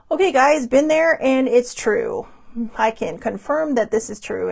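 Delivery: high, loud, rattled "it's true"; shaken excited confirmation. Pretend. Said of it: "I'll just be like".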